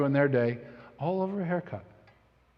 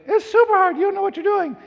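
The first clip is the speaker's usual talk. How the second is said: high mock wife voice, a bit louder; squeaky put-on wife scolding; imitating wife; 5 dB louder than usual. Husband mimics wife warning him off the haircut, high mock voice. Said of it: "And she's like".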